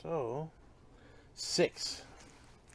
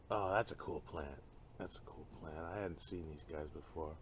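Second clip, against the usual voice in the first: ordinary talk, nothing marked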